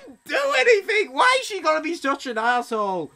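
high-pitched shouted complaint; shrieked protest falling into grumbling; aggrieved wail. Real, not acted. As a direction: wail at the unfairness. Wails after being called criminal.

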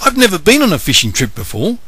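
excited. Acted. Nathan thrilled.

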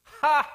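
sharp, loud, high "ha!"; a single barking laugh of scorn; scoffing laugh. Real, not acted. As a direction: scoff at the sceptics. Scoffing at sceptics.

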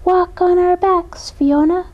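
lilting call of the fish chorus; silvery chorus. A narrator's reading. Rainbow fish invite Fiona across, silvery sing-song. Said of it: "a silvery chorus sang out".

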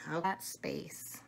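slow hushed whisper. Real, not acted. Hushed in the dark.

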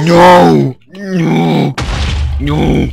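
loud panicked screams. Real, not acted.

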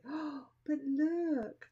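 high, slow, drawn-out gasp of wonder; imitated client's delight. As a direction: voice a client spotting something lovely, stretched out high. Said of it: "And then they're like".

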